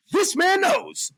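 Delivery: put-on old-time radio announcer build; 1930s announcer. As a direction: boom it like a vintage ring announcer. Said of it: "I sounded like one of those, like, nineteen thirties announcers".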